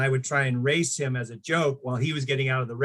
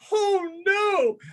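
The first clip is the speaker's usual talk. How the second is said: much higher, startled yelp of dismay; retold teenage panic, pitched way up; 4 dB louder than usual. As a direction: squeak it out like you just saw it rip. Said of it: "And I'm like".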